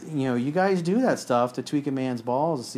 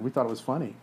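usual speaking voice

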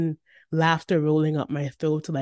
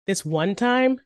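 put-on high, louder kid voice; raised, eager question; eager little brother; 5 semitones higher, 6 dB louder than usual. A narrator's reading. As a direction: ask like a kid with a story. Little brother telling a story.

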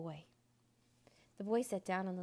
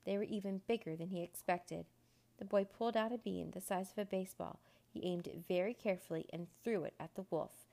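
ordinary delivery, nothing marked

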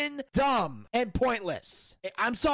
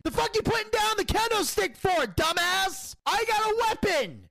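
angry rant; 8 semitones higher than usual. Real, not acted. Podcaster yelling at a wrestler who drops his weapon, furious. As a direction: yell at the screen in disbelief.

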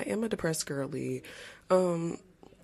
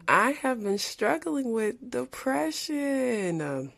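high, drawn-out upbeat announcement; too excited; 9 semitones higher, much slower than usual. Real, not acted. Podcaster opens up to listeners about depression, sounding too excited. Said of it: "I sound too excited to talk about this".

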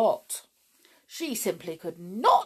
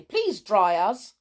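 slow, louder cross demand; cross plea; 2 semitones higher, 6 dB louder, slower than usual. A narrator's reading. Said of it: "cried the cowboy doll crossly".

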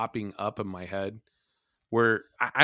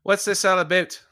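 put-on Mid-Atlantic accent; 10 semitones higher, 8 dB louder than usual. Mock accent play continues.

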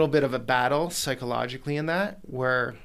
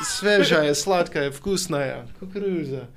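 loud sing-song street vendor cry; hawker calling in Russian; 3 semitones higher, 3 dB louder, slower than usual. Retold or imitated. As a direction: sell the corn to passers-by on the beach. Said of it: "I'm out there yelling, selling corn in Russian".

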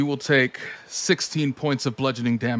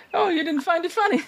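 much higher voice, laughing through it; high laughing character voice; faster than usual. Acted.

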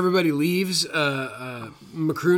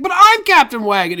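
higher, louder mock indignation; indignant Waggett; 10 semitones higher, 10 dB louder than usual. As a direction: protest as the pompous captain who can't believe it. Waggett stopped by his own guard.

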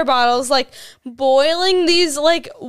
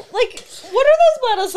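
laughing disbelief; 9 semitones higher, faster than usual. Real, not acted. Retelling friend's vodka chugging.

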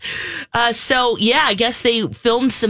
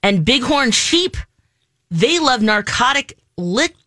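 ordinary delivery

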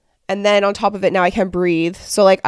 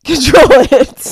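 laughing; 6 semitones higher, 10 dB louder, slower than usual. Retold or imitated. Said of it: "I'm like".

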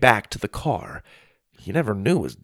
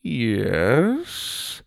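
drawn-out, snobbish query. A narrator's reading. Butler greets Jake and his mom, snobbish. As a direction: say it with haughty disdain. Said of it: "in a snobbish tone".

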